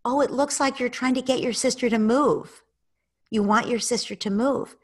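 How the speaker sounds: empathizing parent. Shown as a demonstration. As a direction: reflect the child's wish kindly. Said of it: "You might empathize".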